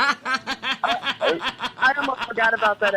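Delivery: talking through delighted laughter; real laughing. Real, not acted. Host reminded of Chris Christie, laughing as she talks. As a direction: giggle your way through the words. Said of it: "brought joy to my heart".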